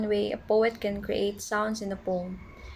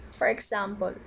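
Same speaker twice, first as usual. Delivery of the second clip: ordinary talk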